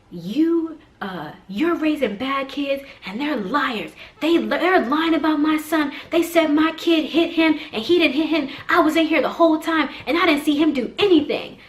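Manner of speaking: high, heated mock rant; irate mother. Irate mom accuses the other mom's kids of lying, ranting. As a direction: imitate a furious mom accusing someone.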